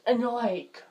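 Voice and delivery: ordinary talk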